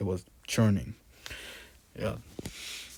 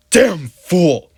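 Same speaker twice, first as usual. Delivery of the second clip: much higher, much louder insult; furious shout; 9 semitones higher, 15 dB louder than usual. A narrator's reading. Priest raging at Mister Jones, shouting. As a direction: bellow it in a rage. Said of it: "shouted the priest".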